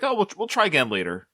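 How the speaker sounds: high-pitched, breezy mock brush-off; playing it off. Man voicing Rocky deflecting rejection, playing it off. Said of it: "he wanted to play it off".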